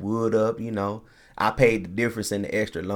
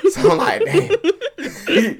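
laughing; 7 dB louder, much slower than usual. Retold or imitated. His own reaction, laughing.

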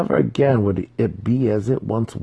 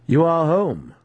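raised, absent greeting; weapon master greeting; 9 semitones higher than usual. A narrator's reading. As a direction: toss it off, distant.

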